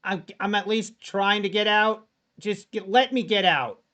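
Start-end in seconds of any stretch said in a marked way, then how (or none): none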